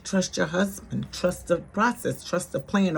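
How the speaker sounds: soft, unhurried soothing words; calm reassurance. Retold or imitated. Brother-in-law soothing his angry sister-in-law, softly calm. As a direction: gently talk her down, no yelling. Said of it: "Orlando would just calmly".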